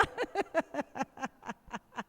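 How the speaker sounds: long, trailing run of chuckles; laugh. Real, not acted. Laughs after the arepas joke. (risa larga que se apaga)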